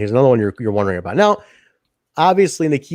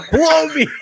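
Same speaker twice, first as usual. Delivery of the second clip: high-pitched taunt through laughter; amused mock taunt, much higher; laughing echo; 14 semitones higher, 4 dB louder than usual. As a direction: repeat the jeer while cracking up. Echoing the taunt while laughing.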